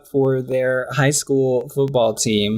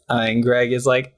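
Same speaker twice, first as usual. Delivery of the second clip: ordinary talk, nothing marked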